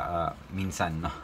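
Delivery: normal talking voice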